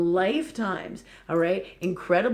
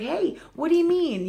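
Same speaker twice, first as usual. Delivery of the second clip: mock-indignant protest, pitched up sharply; higher-pitched, put-out objection; pissed off; 10 semitones higher, 3 dB louder than usual. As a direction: retell it as a high, indignant challenge. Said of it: "I was pissed off and I was like".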